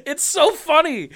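high, drawn-out squeal of amusement; helpless giggly high voice; real laughter. Real, not acted. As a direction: say it while cracking up. Cracking up at the twist.

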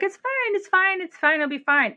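put-on a mom chirping fine over and over to calm a panicking child; frantic mom reassuring. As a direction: pitch starts very high and steps down with each fine. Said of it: "the mom's like".

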